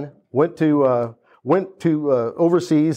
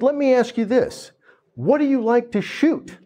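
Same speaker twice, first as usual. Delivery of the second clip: higher, knowing salesman voice; lightly higher probing salesman; 8 semitones higher than usual. Retold or imitated. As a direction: lead her with a question. Salesman questions customer.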